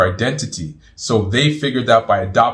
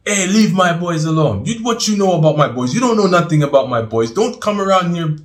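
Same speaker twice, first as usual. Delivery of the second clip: raised, pitched-up protective warning; Abdul Azim, heavy voice; 9 semitones higher, 3 dB louder than usual. Retold or imitated. Elder defending his boys in the masjid, heavy-voiced warning. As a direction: imitate a mosque elder's forceful scolding. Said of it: "he's got this heavy voice".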